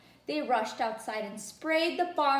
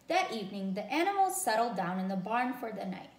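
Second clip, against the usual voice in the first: plain reading voice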